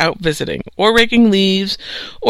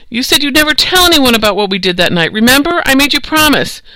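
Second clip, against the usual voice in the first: raised pitch, accusing a friend; hurt reproach; 7 semitones higher than usual. Acted. Lewis reminding Tarby of his promise, hurt reproach. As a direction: protest a broken promise, voice climbing.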